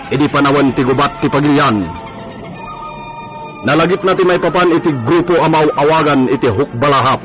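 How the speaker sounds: ordinary talk, nothing marked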